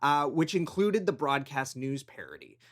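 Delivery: quick recorded aside; a soft recorded note; podcast insert read. Real, not acted.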